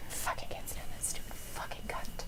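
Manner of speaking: bitter whispered curse under the breath. Real, not acted. Watching the match, cursing the wrestler in a whisper. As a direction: spit it out in a whisper.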